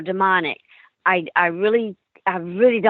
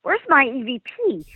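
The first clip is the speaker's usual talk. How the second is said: alarmed surprise; 6 semitones higher than usual. Retold or imitated. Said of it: "And I was like".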